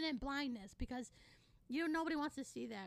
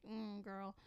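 sassy dismissal; 2 semitones lower, 4 dB louder, slower than usual. Retold or imitated. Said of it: "I'm like".

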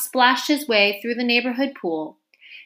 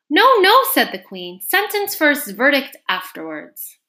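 high, very loud royal objection; imperious outburst; 5 semitones higher, 6 dB louder than usual. A narrator's reading. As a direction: cut in loudly to overrule. Queen overrules the king.

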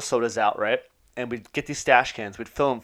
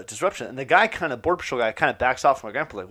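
normal talking voice

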